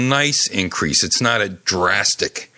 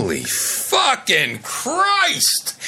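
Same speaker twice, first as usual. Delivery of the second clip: shocked exclamation; 12 semitones higher, much slower than usual. Real, not acted. Reacts to the weekly segment.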